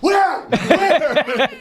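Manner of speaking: high startled yell of where; alarmed shout. Retold or imitated. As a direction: jump up looking for the war. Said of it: "Tim stands up and goes".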